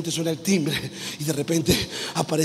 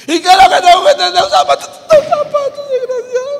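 much higher, loud, tearful shrieking; hysterical wailing rage; 11 dB louder than usual. Acted. Ruth wailing and shrieking over the shoe. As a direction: wail it in comic hysterics.

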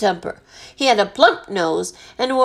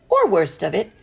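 plain reading voice

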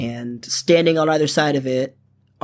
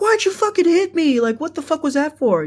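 very high-pitched, aggrieved imitation; mock indignant whine; 14 semitones higher than usual. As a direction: play up the hurt guy's outraged protest. Bleeding man protests being hit.

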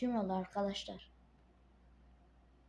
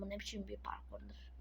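whispered aside; 12 dB quieter, faster than usual. Real, not acted. Whispering to himself about the course. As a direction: breathe it to yourself, fast.

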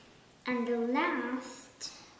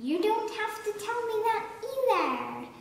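much higher and fast, cutting in eagerly; excited high cry; 9 semitones higher, much faster than usual. A narrator's reading. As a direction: blurt it out high and quick, the Duke can't wait. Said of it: "cried the Duke".

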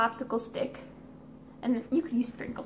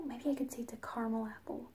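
whispering; 9 dB quieter than usual. Real, not acted. Scheming whisper aside.

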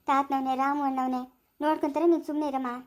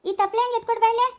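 loud, higher call across the house; 6 semitones higher, 4 dB louder than usual. Acted. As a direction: shout to someone in another room. Wife calls out from inside. (ಒಳಗಿನಿಂದ ಜೋರಾಗಿ ಕೂಗುವುದು)

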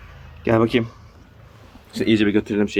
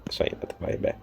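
laughing whisper; 9 dB quieter than usual. Real, not acted. Laughing while fleeing the nun.

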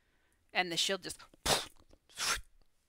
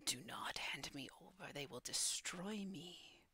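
voice in the head; 11 dB quieter than usual. Acted. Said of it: "you do hear in your voice".

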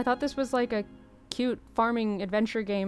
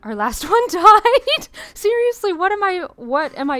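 much higher, loud, laughing disbelief; shocked outburst; 9 semitones higher, 10 dB louder than usual. Real, not acted. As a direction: squeal in amused shock. Shocked the last hero died.

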